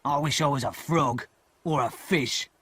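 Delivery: gruff-voiced convict, gloomy; a weary escaped convict grumbling. A narrator's reading. Convict answering the boy on the marsh, glum.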